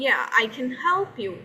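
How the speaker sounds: slow native-English "help" with a dark, twisted l; native English accent. Shown as a demonstration. Teacher contrasting with Pakistani style, native English accent. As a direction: stretch it out in native style, tongue curled on the l of "help". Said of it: "pronunciation थोड़ी सी change की".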